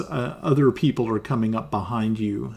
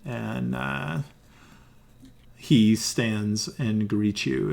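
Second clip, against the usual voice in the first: ordinary delivery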